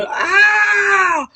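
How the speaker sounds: long, high-pitched acted shriek; storyteller's piercing mock scream. A narrator's reading. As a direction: scream out in one held high note. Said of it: "You just hear Maynard scream".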